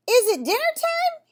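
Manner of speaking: high, loud call across the hill. A narrator's reading. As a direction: call it up to the moose. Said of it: "he called".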